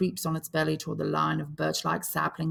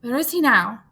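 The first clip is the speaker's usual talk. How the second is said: higher, loud demand; urgent fear; 7 semitones higher, 10 dB louder than usual. Acted. Piggy demanding an answer from the boys, loud and afraid. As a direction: insist on an answer.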